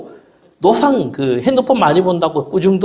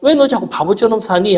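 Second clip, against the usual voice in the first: higher, teasing scold; father teasing daughter for acting foolish; mock scolding father; 7 semitones higher than usual. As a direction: needle her with a pitched-up question. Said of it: "하면".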